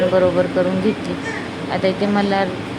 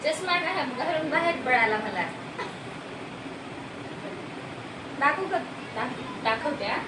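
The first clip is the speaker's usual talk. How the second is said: sing-song coaxing, much higher and softer; 10 semitones higher, 12 dB quieter than usual. Real, not acted. Mother coaxing her toddler to walk out, high soft baby talk.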